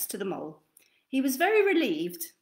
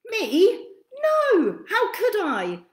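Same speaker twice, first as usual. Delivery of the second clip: high, loud, bright little bird; sing-song bird denial, high and loud; high dove voice; 10 semitones higher, 7 dB louder, slower than usual. Acted. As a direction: squeak it out like a small dove. Dove answers the mole.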